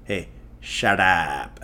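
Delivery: slow, drawn-out Indian film "Hey, shut up."; Indian movie accent. Shown as a demonstration. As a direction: stretch "shut up" like a Tamil film hero. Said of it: "In Indian movies, that's how they say".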